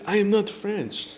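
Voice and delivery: high, loud mock French accent; a spy badly hiding his French accent. French spy denying he is French, hammy French accent. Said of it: "talking with that accent".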